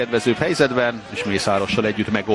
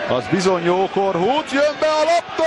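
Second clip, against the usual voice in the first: excited commentary; 8 semitones higher than usual. Real, not acted. Cross coming in.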